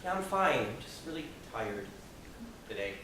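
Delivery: "Yeah, I'm fine" then a soft, fading "really tired today"; tired brush-off. Retold or imitated. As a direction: mumble it like he's covering something. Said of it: "the only thing he could come up with saying is he said".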